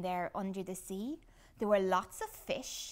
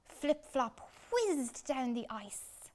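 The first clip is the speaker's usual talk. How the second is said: ordinary delivery, nothing marked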